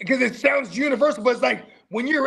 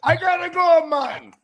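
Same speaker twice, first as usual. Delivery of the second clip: put-on higher-pitched joke Jamaican accent; 5 semitones higher, 4 dB louder than usual. Podcaster joking about people with twelve jobs, mock Jamaican accent. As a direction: play an overworked worker with a mock Jamaican accent. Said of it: "assume Irish people are the ones".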